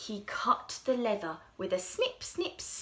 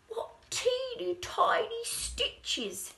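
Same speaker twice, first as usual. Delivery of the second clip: high, slow, crying out in amazement; louder, more astonished than the first time; shoemaker's wonder, bigger; 11 semitones higher, 3 dB louder, slower than usual. Acted. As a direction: marvel even more at the stitches. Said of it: "he said".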